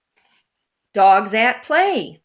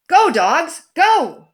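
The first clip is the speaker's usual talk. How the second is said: higher, louder, a rousing little cheer; cheering dogs on; 7 semitones higher, 4 dB louder, slower than usual. Acted. Reading to a child, cheering the dogs on excitedly. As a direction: urge the racing cars on.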